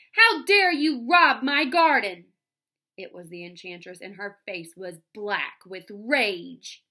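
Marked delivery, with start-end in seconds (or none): 0.1-2.3 loud, high, slow outrage; enraged shriek. A narrator's reading. Enchantress to the man robbing her garden, shrieking with rage. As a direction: hurl it out as a shrieked accusation. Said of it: "shrieked a voice".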